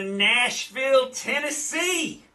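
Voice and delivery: drawn-out, rising announcer flourish; showy announcer call. Pretend. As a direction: sing out the city like a ring announcer. Announcing the next game's city.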